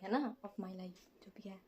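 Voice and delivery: whispered aside. Real, not acted. Vlogger muttering an aside to viewers, whispered. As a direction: drop to a whisper.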